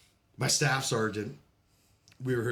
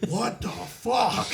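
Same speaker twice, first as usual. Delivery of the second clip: shocked outburst; 9 semitones higher, 4 dB louder, much slower than usual. Retold or imitated. Shocked at casualty point.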